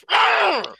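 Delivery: high exasperated mock growl; Jonathan. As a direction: let out an outraged "arrgh". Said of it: "Jonathan would say".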